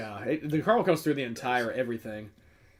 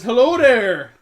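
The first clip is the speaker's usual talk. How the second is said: greeting voice; 7 semitones higher, 8 dB louder, slower than usual. Retold or imitated. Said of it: "It says".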